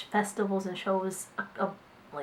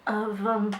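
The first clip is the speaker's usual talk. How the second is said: choked up. Real, not acted. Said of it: "I don't know why I'm getting emotional".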